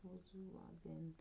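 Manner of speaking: Volkswagen very soft and slow, "voz-wagen"; trying out pronunciation. Shown as a demonstration. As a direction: sound out Volkswagen under your breath with a W. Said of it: "How do you pronounce it before?".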